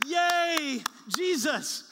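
the congregation cheering when the song reaches the resurrection; joyful cheer. Retold or imitated. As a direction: burst out like a happy kid at church. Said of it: "the Spirit of God in you says".

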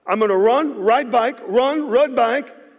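excited announcement. Retold or imitated. Pastor announcing his run to the church, excited and loud. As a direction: fire up the crowd. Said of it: "So I get excited".

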